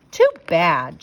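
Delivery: loud, firm "too bad"; shouted. A narrator's reading. Big brother shouting at stubborn sister, loud. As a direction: shout it down at her. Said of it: "shouted Henry".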